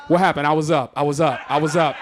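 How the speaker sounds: lightly voiced teenage son; a hint of a flustered son. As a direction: play the caught kid mumbling excuses. Son's excuse voiced.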